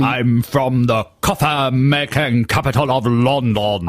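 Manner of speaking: slow, stretched affected British vowels; mock posh British. Visitor to America answers admirer, mock posh British. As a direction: parody the coach's British dialect. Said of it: "started talking like that".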